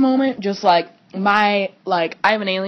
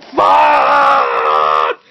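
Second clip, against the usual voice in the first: anguished yell; 5 dB louder than usual. Retold or imitated. Retelling his own anguished outburst.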